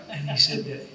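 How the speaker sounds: ordinary talk, nothing marked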